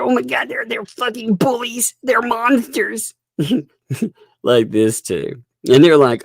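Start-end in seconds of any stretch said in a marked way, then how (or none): 0.0-3.1 high-pitched mock-horrified complaint; mock outrage. Imitating offended people calling others bullies, mock outrage.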